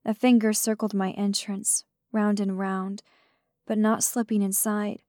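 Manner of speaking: usual speaking voice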